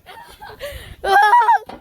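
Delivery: squealing laughter; high laugh. Real, not acted. Laugh on the swing.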